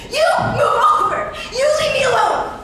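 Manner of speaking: yelling with all her strength; furious shout. Acted. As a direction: scream it at him in defiance. Girl shouting at her captor.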